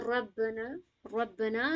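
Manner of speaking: ربنا with the final نا drawn out; stretched na. Shown as a demonstration. Teacher shows students the long نا. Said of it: "نون الف زبر نا میں کھینچیں گے".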